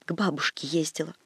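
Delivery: put-on tearful girl's faint whisper. A narrator's reading. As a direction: barely whisper it, close to tears. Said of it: "прошептала".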